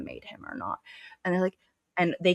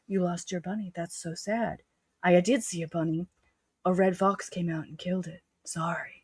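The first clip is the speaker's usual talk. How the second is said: soft, hushed mock heartthrob; 2 semitones higher, 4 dB quieter than usual. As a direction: murmur it like a brooding love interest.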